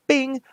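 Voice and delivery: short, high bing; ping it like a warning beep; imitated alert chime. Player voicing the gas detected alert, high chime.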